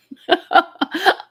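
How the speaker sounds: bright burst of laughter; high laugh. Real, not acted. Mom laughing at a burnt pot. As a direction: laugh out loud at the memory.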